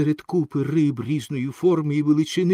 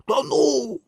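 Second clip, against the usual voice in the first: high, drawn-out cry of a drowning dog; frightened yelp; 10 semitones higher, slower than usual. A narrator's reading. Drowning dog barks to the boy in terror, frightened high cries. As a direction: yelp it out in panic, much higher. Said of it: "він прогавкав, вирячивши від страху очі".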